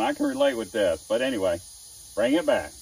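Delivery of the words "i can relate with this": much higher, louder wry self-voice; hammed-up wry thought; mock inner thought. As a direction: chirp it in sympathetic self-mockery. His own inner thought, voiced.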